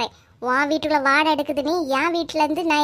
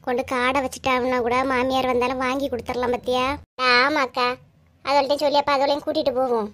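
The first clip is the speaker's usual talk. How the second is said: ordinary talk, nothing marked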